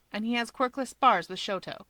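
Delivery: usual speaking voice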